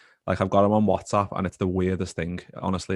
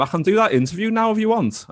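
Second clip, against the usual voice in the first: much higher, louder casual offer; lightly voiced Momo; 13 semitones higher, 5 dB louder than usual. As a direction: toss it off breezily in a lifted voice. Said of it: "And he was like".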